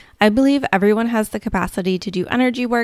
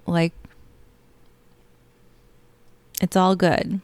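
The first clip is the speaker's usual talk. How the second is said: guided meditation. Acted. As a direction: lull them gently into calm.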